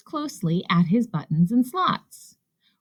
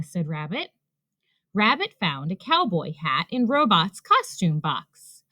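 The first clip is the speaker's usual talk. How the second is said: plain reading voice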